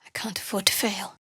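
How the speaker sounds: hushed unvoiced whisper. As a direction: breathe it to yourself. Anxious inner thought.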